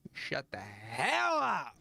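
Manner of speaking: slowly, fed up with the ad; slow annoyed yell. Real, not acted. Streamer still fed up with the ad, slow annoyed yell. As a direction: drag it out, fed up. Yelling at the ad again.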